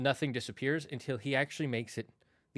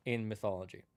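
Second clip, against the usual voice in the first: ordinary talk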